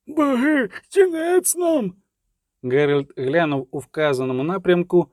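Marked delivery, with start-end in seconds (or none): 0.1-1.9 high, drawn-out cry of doom; panicked ferryman's wail, pitched very high; dismayed cry. A narrator's reading. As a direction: cry out in dismay, stretching the words. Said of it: "крикнув перевізник, і, скажімо так, нерадісно".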